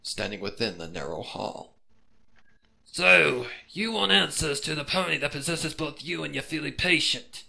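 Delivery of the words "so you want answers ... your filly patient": raised, raspy questioning pony; pony sizes up the detective, raised and raspy. Acted. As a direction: rasp it out like a suspicious hermit. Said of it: "asked the pony in a raspy voice".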